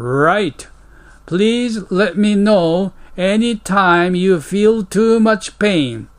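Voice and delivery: slow, careful English read for learners; slow model reading. Shown as a demonstration. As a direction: read each word out slowly and clearly. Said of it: "English model dialogue read slowly". (ゆっくり丁寧な英語の模範読み)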